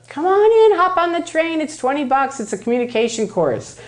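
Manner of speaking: high, bright sales pitch voice; salesy recruiter. Retold or imitated. Recruiter welcoming newcomers onto the train, salesy. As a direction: lure them in like a cheery barker.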